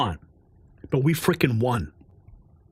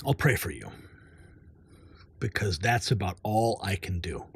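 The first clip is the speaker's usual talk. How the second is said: much lower and softer, fake pious sympathy; mock solemn pity; 9 semitones lower, 7 dB quieter than usual. Mocking vaccinated viewers with lower, softer fake prayer. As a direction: murmur it like a pitying prayer.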